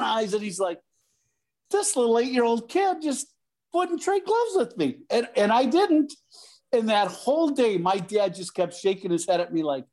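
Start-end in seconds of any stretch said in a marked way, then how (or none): 1.7-5.0 much higher, incredulous mock voice; imitated disbelief. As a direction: sound stunned that a kid said no. Suter's disbelief at the kid.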